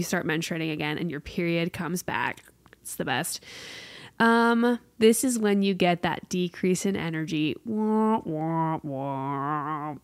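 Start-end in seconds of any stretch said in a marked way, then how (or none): 2.8-3.4 whispered, dry sarcasm; sarcastic whisper. Real, not acted. Sarcastic aside about periods. 7.6-9.9 mock wah wah. Mock sad trombone.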